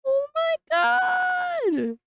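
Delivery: high, slow, loud screaming joy; overjoyed shriek; excited shout. Real, not acted. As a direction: scream it out in disbelief. Screaming over the card.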